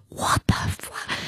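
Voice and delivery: a slow, hushed whisper of disbelief; stunned whisper. Retold or imitated. Thinking to himself in the empty church, a stunned whisper. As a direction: breathe it out, half swallowing the swear.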